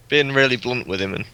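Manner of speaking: usual speaking voice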